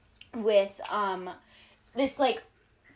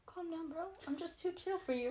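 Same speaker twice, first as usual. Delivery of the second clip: soft, higher mock-chill brush-off; mock-cool; 4 semitones higher, 15 dB quieter than usual. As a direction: play it too cool, barely bothering to speak up.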